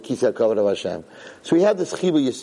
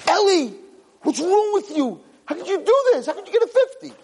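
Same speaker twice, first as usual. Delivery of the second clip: angry yelling parent. Retold or imitated. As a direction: shout at the kid over his grades. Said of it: "I'm like".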